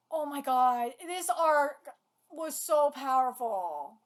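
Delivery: ordinary delivery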